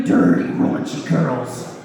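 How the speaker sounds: lecherous slow low drawl; sleazy low murmur. Acted. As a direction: drag the word out low and dirty. Lowered dirty emphasis.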